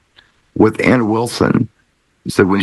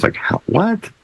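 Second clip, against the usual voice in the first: astonished; 11 semitones higher, 4 dB quieter, slower than usual. Real, not acted. Seeing tiny Angus with bodyguard.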